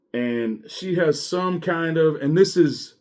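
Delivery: usual speaking voice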